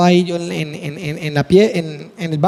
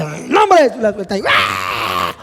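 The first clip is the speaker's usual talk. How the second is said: mock exorcism cry; slower than usual. Pastor reenacting deliverance of his daughter, crying out. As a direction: act out the demon leaving with a cry.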